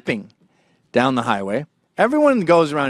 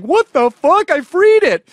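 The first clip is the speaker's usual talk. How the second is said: high, loud incredulous yell; panicked shout; 12 semitones higher, 6 dB louder than usual. Retold or imitated. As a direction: yell it as a man fleeing in disbelief. Man fleeing attacking deer.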